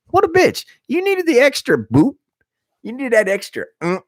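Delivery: very high, squeaky jeer; mocking taunt. Jeering at the man who punched with a can, squeaky mockery. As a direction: tease in a silly high voice.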